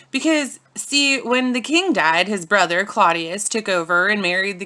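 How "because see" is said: ordinary delivery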